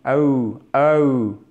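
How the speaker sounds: "OU" as an E sliding into OU; gliding diphthong. Shown as a demonstration. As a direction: isolate the glide from E to OU, twice. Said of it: "un E qui tend vers un OU". (diphtongue « OU » glissant du E vers le OU)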